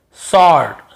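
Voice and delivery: "स्वॉर्ड" as "sawd", loud, with no W; silent W. Shown as a demonstration. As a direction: drop the W in "स्वॉर्ड", said firmly. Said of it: "है ना, स्वॉर्ड नहीं". ("स्वॉर्ड" को सॉर्ड, ज़ोर से)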